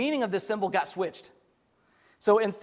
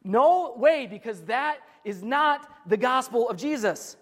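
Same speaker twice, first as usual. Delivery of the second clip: firm, forceful retort as Paul; emphatic; 3 semitones higher, slower than usual. Retold or imitated. Preacher voices Paul rejecting the false teaching, emphatic. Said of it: "I have to get pretty emphatic".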